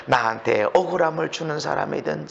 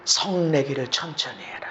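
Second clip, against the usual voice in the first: normal talking voice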